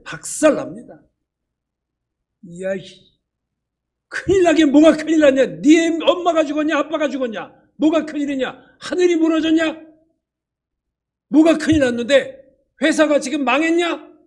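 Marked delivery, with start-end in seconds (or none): 2.6-14.1 loud, high scolding rant; boss scolding. Retold or imitated. Boss berating a panicking worker, loud and high. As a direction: tear into the flustered employee.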